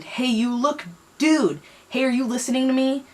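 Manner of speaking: high, urgent call to a stranger. Acted. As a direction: shout a warning up the slope. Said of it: "Wesley screamed".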